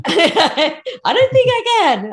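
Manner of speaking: high, laughing through the words. Real, not acted. Guest fails a tongue twister before the host, laughing. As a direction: admit defeat through real laughter.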